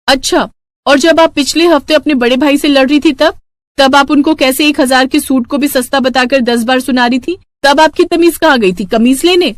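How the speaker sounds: sass her mother-in-law sweetly; bright mock-innocent retort; cheeky daughter-in-law. Bahu argues.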